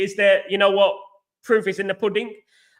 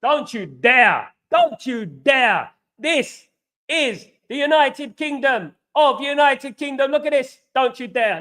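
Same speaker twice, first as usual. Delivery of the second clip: slow, high, emphatic warning; indignant; 6 semitones higher, slower than usual. Real, not acted. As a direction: spell it out indignantly, high and drawn out. Indignantly shows passport to a doubter.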